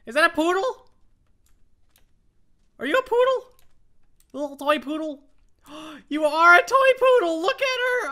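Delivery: very high, loud dog-lover squealing; excited squeal. Real, not acted. Player spotting a toy poodle, squealing with excitement.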